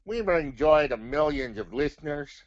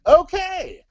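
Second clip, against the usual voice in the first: high excited cry; bright burst of enthusiasm; excited shout; 9 dB louder than usual. Acted. Puppet excited reply.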